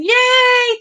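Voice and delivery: high-pitched, happy yay; excited cheer. Real, not acted. Streamer thanking a viewer for a like, excited cheer. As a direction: let out a high, drawn cheer.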